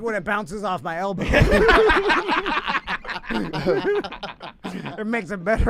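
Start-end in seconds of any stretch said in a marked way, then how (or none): 1.3-4.9 long burst of laughter; real laughter. Real, not acted. Hosts joking about an elbow, long real laugh. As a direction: crack up at the joke.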